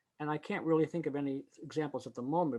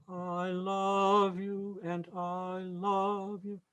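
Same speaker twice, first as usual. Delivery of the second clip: slow, higher, "love" leaned on in each take; tenuto versus crescendo; 4 semitones higher, much slower than usual. Shown as a demonstration. Choir director contrasting two ways to accent a word. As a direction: contrast a momentary lean on "love" with a swell on "love". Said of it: "there is a difference between".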